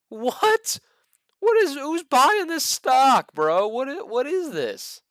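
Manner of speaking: high, loud incredulous outburst; stunned disbelief. Real, not acted. Host to viewers about a stock he can't believe, high loud outburst. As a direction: blurt it out, can't believe anyone owns this.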